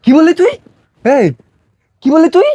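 shocked angry shout. Acted. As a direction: explode at what she just said. Father hears son was sold. (স্তম্ভিত হয়ে রাগে চিৎকার)

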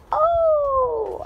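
high, drawn-out "oh" shriek; loud wail of pain far up in pitch. A narrator's reading. Mouse with his tail stuck in a fan, shrieking. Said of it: "I shrieked".

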